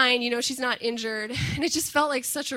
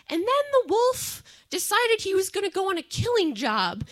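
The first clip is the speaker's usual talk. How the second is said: put-on much higher, childlike voice telling a gory tale; little kid storyteller; 9 semitones higher, 4 dB louder than usual. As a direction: play your first-grade self reading a grisly story into the tape, the ending slowed right down. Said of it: "the stories kinda went like this".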